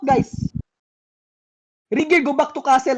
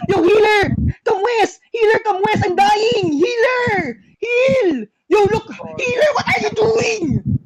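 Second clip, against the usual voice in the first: panicked shout; 6 semitones higher, slower than usual. Real, not acted. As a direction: scream for the healer, you're dying. Dying in fight, yelling at healer.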